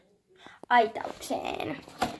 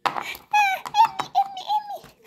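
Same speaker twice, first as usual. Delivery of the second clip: high squeaky call; 3 dB louder than usual. Pretend. Toy horse calling its owner.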